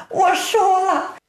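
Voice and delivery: high, slow wailed confession; storyteller's acted weepy wife; tearful wife. A narrator's reading. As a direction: own up in a drawn-out, high whimper. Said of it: "正在屋中掉眼泪呢".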